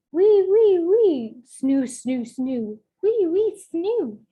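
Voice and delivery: high, loud wheezy snore sounds; squeaky snoring. Acted. Reader acting out the little mummy snoring for kids. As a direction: snore like a sleeping baby mummy.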